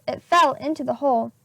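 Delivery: ordinary delivery, nothing marked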